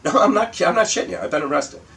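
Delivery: high, loud retold protest, easing off at the end; exasperated insistence. Husband phoning wife from police station, exasperated insistence. Convincing disbelieving wife by phone.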